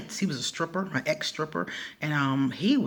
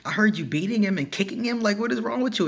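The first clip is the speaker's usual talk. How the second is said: higher and louder, scolding roommate; mock outrage; 6 semitones higher, 4 dB louder than usual. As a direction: play the appalled roommate. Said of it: "So she was like".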